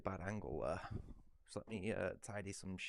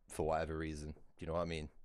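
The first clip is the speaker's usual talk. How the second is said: ordinary talk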